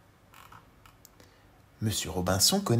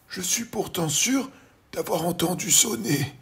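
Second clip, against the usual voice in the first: higher, slowish bewildered voice; puzzled old gentleman; 9 semitones higher, 4 dB louder than usual. Acted. Mr Robinson puzzles at the door, higher voice. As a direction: sound quite sure yet baffled.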